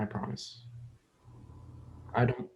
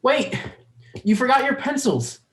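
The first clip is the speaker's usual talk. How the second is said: put-on much higher and louder shout after a running child; calling after; 11 semitones higher, 6 dB louder than usual. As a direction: yell down the hall so she hears. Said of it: "Sam calls after".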